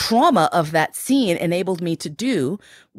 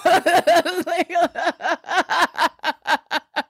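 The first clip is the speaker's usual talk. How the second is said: big laugh; 12 semitones higher than usual. Real, not acted. Telling the bus story, a big delighted laugh.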